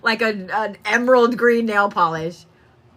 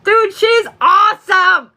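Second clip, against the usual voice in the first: loud, high, drawn-out exclamation; thrilled, laughing; 9 semitones higher, 5 dB louder, slower than usual. Real, not acted. Reader raving to client about the grandmother, thrilled. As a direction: burst out with delighted amazement.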